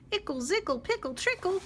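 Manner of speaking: high, loud singsong nonsense; bouncy high chirpy rhyme; silly bird song. Acted. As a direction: chirp it out gleefully. Other birds sing silly song.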